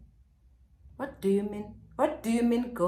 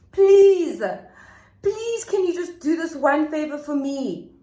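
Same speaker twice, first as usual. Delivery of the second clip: pleading; 6 semitones higher than usual. Retold or imitated. Boyfriend begging the friend in a high, hammed-up whine. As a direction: whine please like a desperate man. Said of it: "there he is begging now".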